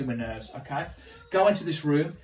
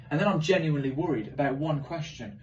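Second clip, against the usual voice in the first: ordinary talk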